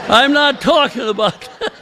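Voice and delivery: high, giggling through the line; laughing quote. Real, not acted. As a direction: crack up while quoting the boss. Quoting the superintendent while laughing.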